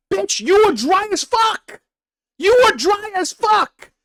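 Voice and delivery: high and slow, you were dry as fuck; outraged accusation. Real, not acted. Streamer accusing the woman of being dry, high outrage. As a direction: hammer it at her, pitch way up.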